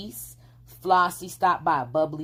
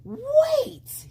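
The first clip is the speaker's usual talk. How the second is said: very high, louder call; calling wait; 5 dB louder than usual. A narrator's reading. Fox calling the girl back, very high. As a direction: call after her as she leaves. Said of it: "he called".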